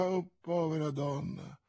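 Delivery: soft, pitying murmur; hushed, quietly grieving; broken murmur. A narrator's reading. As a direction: murmur it with a breaking voice. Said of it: "mormorava Athos con voce spezzata".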